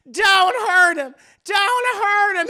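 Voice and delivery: high, loud, drawn-out pleading; hammed-up begging, stretching each word; acted frantic plea. [name] pleads for the dwarf, mock frantic.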